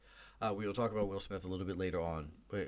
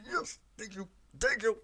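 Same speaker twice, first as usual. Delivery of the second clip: much higher, hammed-up cheer of relief; mock Academy gloating; 12 semitones higher than usual. The Academy thanking the scandal for ratings, mock giddy. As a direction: play the Academy delighted in secret. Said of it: "the Academy in a dark room right now is like".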